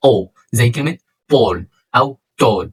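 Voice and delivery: ball and tall with a full rounded aw, slowly; deep full o. Shown as a demonstration. Teacher showing heavy aw in ball and tall. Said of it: "ل O مفخمة".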